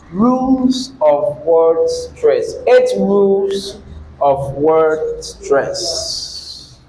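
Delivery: slow, measured, word by word; slow dictation. Real, not acted. Teacher giving the rules of word stress to write, slow dictation. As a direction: draw out each word for note-takers.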